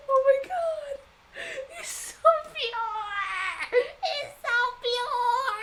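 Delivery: very slow, high, loud sobbing wail; drawn out, overwhelmed, crying with joy; tearful cry. Real, not acted. Overcome by cute character.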